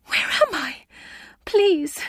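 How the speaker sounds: high panicked plea; frightened pleading. A narrator's reading. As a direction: beg in terror, voice climbing. Terrified patient on trolley.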